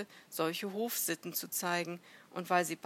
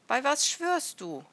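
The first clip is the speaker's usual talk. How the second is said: high, loud questioning; raised, demanding question; insistent challenge; 8 semitones higher, 9 dB louder than usual. A narrator's reading. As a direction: press for an oath from a stranger. Acted wary challenge across the bushes.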